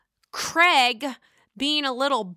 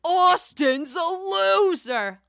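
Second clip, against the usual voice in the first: drawn-out, louder, higher jeer; mocking quote; 8 semitones higher, 4 dB louder, much slower than usual. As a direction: imitate him dragging out a jeering insult. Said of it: "and he says".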